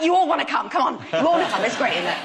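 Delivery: loud, high sing-song coaxing; cheerful coaxing call. Retold or imitated. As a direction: call the rocks over cheerily. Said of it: "I'm like".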